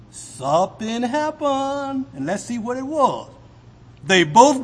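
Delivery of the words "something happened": Something happened, drawn out slower and higher; stress on happened. Acted. Preacher repeating his point, higher and slower. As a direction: lift and stretch happened.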